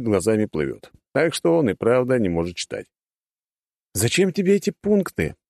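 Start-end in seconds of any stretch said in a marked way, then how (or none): 3.9-5.4 put-on sly cheerful young man; grinning; smiling captor. A narrator's reading. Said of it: "улыбнулся похититель".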